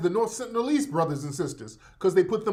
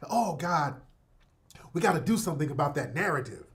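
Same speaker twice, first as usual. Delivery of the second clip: whispered, fast mock panic; 4 semitones lower, 6 dB quieter than usual. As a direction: whisper it like a worried plotter. Mocking the guests' worry.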